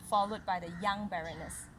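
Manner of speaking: ordinary delivery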